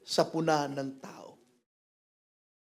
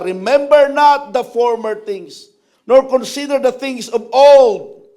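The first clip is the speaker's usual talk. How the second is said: scripture reading; 10 dB louder than usual. Acted. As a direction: declaim it like a prophecy. Reads Isaiah 43 aloud.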